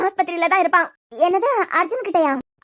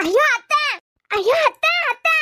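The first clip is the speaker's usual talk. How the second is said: high-pitched panicked call for auntie; urgent, frightened calling; alarmed cry; 6 semitones higher, slower than usual. Acted. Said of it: "நீ என்னடா அத்தை அத்தைன்னு அலறுன".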